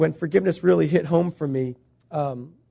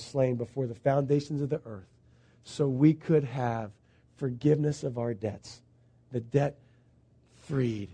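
hushed preaching; 4 semitones lower, 7 dB quieter than usual. Acted. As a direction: bring it down to a hushed altar call. Hushed climax of the sermon.

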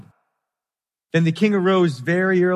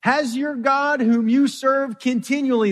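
lamenting call; 10 semitones higher, 3 dB louder than usual. Retold or imitated. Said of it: "cried out with a lamenting voice".

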